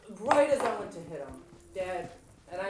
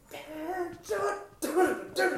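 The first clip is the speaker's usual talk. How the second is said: higher mock rant trailing into da-da; heated retort; 8 semitones higher, 4 dB louder, slower than usual. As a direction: rattle off an angry reply half-mocking. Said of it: "It's like".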